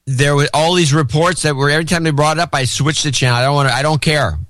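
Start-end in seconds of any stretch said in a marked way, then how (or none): none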